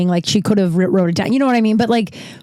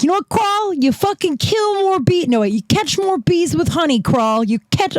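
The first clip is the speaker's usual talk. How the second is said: much higher-pitched mock Brooklyn accent; attempted Brooklyn accent; 9 semitones higher than usual. Old New York boss advising a worker, in an attempted Brooklyn accent. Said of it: "I'm trying to do a Brook-, Brooklyn accent".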